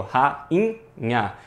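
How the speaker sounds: "Rainha" said in three parts, ra-in-nha; separated syllables. Shown as a demonstration. Teacher breaking down the word for students, "Rainha" in separate syllables. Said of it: "em sílabas separadas".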